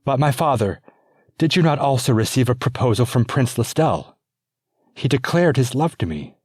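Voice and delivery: pleading princess. Acted. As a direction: press for the man you love.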